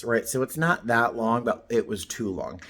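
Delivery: ordinary talk, nothing marked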